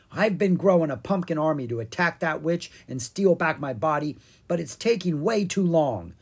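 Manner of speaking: a hint of an impatient monarch; grumbling Pumpkin King.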